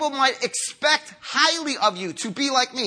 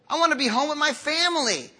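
mock whine; 3 semitones higher than usual. Pastor voices his reluctance at airport.

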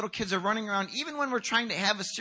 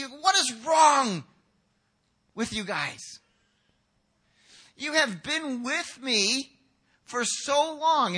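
high, slow, exasperated outburst; angry indignation; 6 semitones higher, 4 dB louder, much slower than usual. Acted. Jesus rebuking his disciples for turning children away, angry indignation. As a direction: erupt loudly, drawing out the words. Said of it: "he was angry".

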